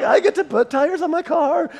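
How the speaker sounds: joy over the paycheck, pitched high; excited. Retold or imitated. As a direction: burst out with delight over the new tires. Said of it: "I was so excited".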